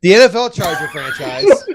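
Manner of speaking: laughing through a loud line; cracking up mid-read. Real, not acted.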